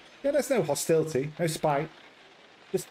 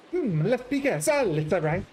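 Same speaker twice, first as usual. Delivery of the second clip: put-on playful, higher Italian accent; 6 semitones higher than usual. Patrizia guessing who the visitor is, teasing Italian accent. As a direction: tease her with a knowing Italian lilt.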